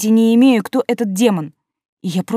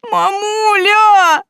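loud sobbing wail, much higher; crying; 13 semitones higher, 5 dB louder, much slower than usual. Acted. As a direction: wail it while bawling. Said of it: "ревёт".

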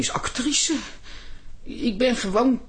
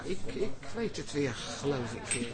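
much softer, hesitant mumble; meek mumble; 5 semitones lower, 10 dB quieter than usual. Acted. Embarrassed actress admitting she half remembers, soft and meek. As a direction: shrink back unsure.

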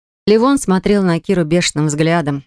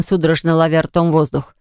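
ordinary delivery, nothing marked

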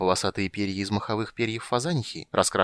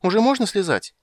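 put-on high, loud child asking; childish eager question; little girl voice; 11 semitones higher, 6 dB louder than usual. A narrator's reading. (голос маленькой девочки)

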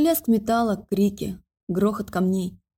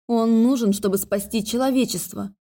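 ordinary delivery

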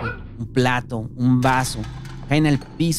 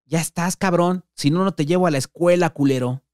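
shouting impatiently; 6 semitones higher than usual. Acted. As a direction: bark it at the kid from across the house. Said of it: "Juan vuelve a gritar".

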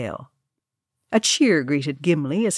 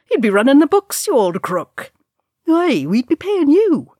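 high, loud teasing shout; rowdy jeer; 10 semitones higher, 7 dB louder than usual. Acted. Young dwarves jeer at the tavern keeper, high and loud. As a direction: rib the old crook.

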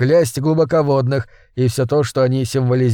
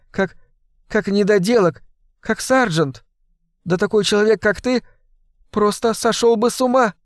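much higher, pleading woman's voice; frantic Sarah; 6 semitones higher than usual. Acted. Sarah urges John over the phone, high and pleading. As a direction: beg him to see reason.

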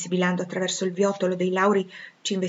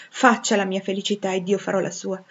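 plain reading voice

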